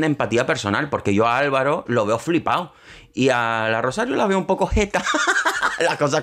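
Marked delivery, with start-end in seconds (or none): 4.5-6.2 words melting into a high laugh; a squeal of laughter at her own joke. Real, not acted. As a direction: laugh through the end of the line.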